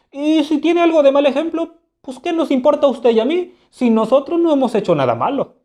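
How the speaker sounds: high, mocking retort to the king; Hamlet burlón. Hamlet replica al rey con burla. (Hamlet burlón, con voz mucho más aguda)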